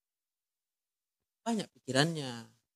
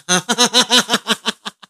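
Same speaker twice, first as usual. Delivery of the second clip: loud, higher burst of laughter; hearty laugh; 4 semitones higher, 7 dB louder than usual. Real, not acted. Counsellor reacting to "gini aja", loud hearty laugh. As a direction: laugh out in a long run of ha-has. Amused after patient's answer.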